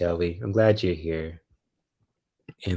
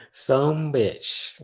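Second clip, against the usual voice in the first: country twang, higher and stretched; country accent; 5 semitones higher than usual. Pretend. Streamer savouring her catchphrase, playful country accent. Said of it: "And got the country accent".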